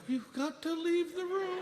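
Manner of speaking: high, slow desperate insistence; mock desperate, high and slow; pleading insistence. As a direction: beg her to get out. Insisting nurse leave.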